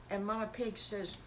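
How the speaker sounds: usual speaking voice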